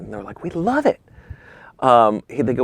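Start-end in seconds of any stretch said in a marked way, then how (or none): 0.4-1.0 high-pitched gushing; mock delight. Clients reacting to a photo shoot pitch, gushing. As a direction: imitate thrilled clients, voice shooting up.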